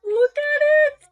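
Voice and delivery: high, loud excited squeal. Real, not acted. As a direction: gush over it, thrilled. Excited over the crown.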